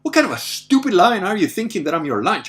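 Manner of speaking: retold indignation. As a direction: scold the lion for daring to see you as lunch. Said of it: "that was the kind of feeling that I had".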